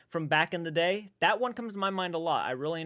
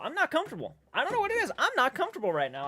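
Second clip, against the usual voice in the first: higher, whining that he's not comfortable; a hint of a high whiny gripe; whiny high complaint; 10 semitones higher, faster than usual. Real, not acted. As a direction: whine it up high in mild discomfort. Uncomfortable in chair, whining.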